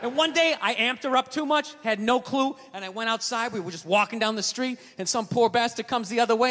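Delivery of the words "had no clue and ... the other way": ordinary talk, nothing marked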